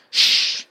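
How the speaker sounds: sharp hissed shush; loud hush. Acted. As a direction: hush them hard. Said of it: "said Hagrid again".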